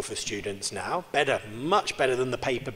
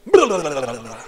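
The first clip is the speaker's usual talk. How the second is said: mock fast gabble; 11 dB louder than usual. Mimicking Irish people talking too fast, loud mock gabble. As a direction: rattle off gibberish like an unintelligible fast talker. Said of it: "And they say".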